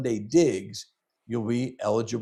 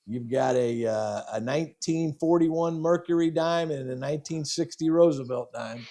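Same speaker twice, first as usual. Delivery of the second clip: ordinary delivery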